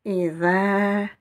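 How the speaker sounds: Ida, last vowel drawn out evenly; prolonged vowel. Shown as a demonstration. Teacher repeating the word, final vowel prolonged. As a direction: hold the end of Ida long. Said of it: "Vous avez ici la prolongation".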